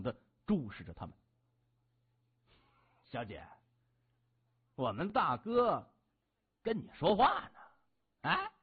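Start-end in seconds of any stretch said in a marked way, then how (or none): 3.1-8.6 slow, drawn-out thug taunt, rising at the end; mocking gangster sidekick; taunting henchman. A narrator's reading. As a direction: drag out each word to needle her. Henchman menacing woman in narrated story.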